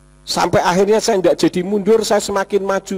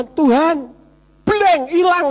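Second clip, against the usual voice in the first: doa gugup; 9 semitones higher, slower than usual. Retold or imitated. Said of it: "Saya berdoa itu cuma".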